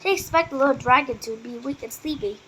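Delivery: ordinary delivery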